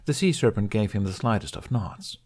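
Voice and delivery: ordinary delivery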